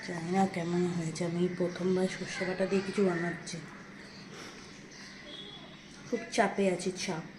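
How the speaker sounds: hushed, low secretive voice; speaking softly. Real, not acted. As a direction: keep it very quiet so the parents don't hear the surprise. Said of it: "আস্তে আস্তে বলছি". (নিচু গলায় আস্তে আস্তে)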